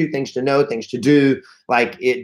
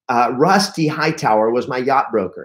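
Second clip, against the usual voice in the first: ordinary delivery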